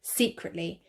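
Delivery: plain reading voice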